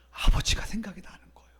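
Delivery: breathy, hurried near-whisper; hushed realization. Real, not acted. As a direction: let it out softly, almost whispered. Prodigal son remembers his father. (속삭이듯 나직하게)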